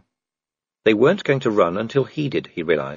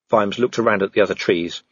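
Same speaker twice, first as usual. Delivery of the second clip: plain reading voice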